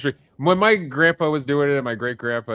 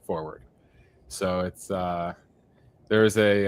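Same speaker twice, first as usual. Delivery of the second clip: ordinary talk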